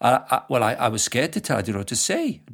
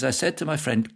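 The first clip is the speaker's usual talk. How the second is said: ordinary talk, nothing marked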